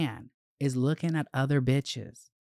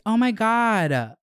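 excited gasp; 9 semitones higher, 5 dB louder, slower than usual. Real, not acted. Switching to exciting news.

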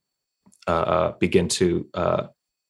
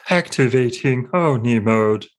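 flat synthetic system announcement, a bit higher; robotic text-to-speech voice; 5 semitones higher than usual. Acted. Game master voicing the suit's system, robotic readout. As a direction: announce it like an old computer booting up. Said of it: "kind of robotic, uh, text-to-speech voice goes like".

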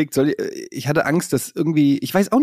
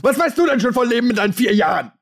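ranting parent; 8 semitones higher than usual. Pretend. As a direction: lecture a four-year-old with a shout. Mock parent ranting at toddler.

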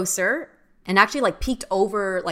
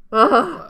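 loud, higher shudder of "ugh"; creeped-out ugh; 7 semitones higher, 8 dB louder than usual. Real, not acted. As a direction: let out a real shudder at the memory. Shudders after her creepy story.

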